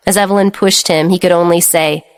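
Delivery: ordinary delivery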